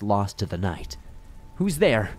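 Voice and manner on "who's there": high, raised panicky call; alarmed, high-pitched challenge; panicked exclamation. A narrator's reading. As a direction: call out as panic rises in your throat. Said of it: "I exclaimed, panic rising in my throat".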